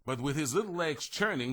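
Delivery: ordinary delivery, nothing marked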